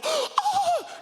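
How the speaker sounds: high gasping "ah"; gasp, then let out a high exaggerated moan; mock moan. Shown as a demonstration. Comedian showing how to unsettle security feeling on you, mock moan. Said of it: "just go".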